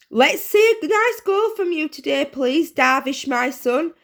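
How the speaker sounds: high, loud proud mum call; touchline cheer. A narrator's reading. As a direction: call out to the pitch.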